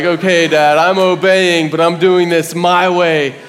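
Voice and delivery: obey grudgingly, insist on your way; reluctant obedience. Retold or imitated. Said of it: "It's like this reluctant obedience".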